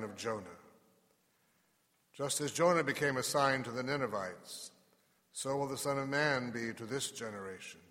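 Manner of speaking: measured, solemn scripture reading; gospel reading. Acted. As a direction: proclaim it from the pulpit. Reads the gospel at Mass.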